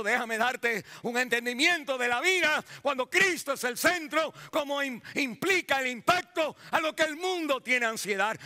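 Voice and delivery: high, driving pulpit voice; impassioned preaching. Acted. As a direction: proclaim it to the whole congregation. Preaches in God's words.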